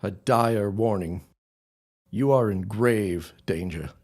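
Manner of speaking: slow, solemn warning; measured, ominous gravity; grave warning. Acted. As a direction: deliver it slowly and gravely. Neighbour delivers a dire warning.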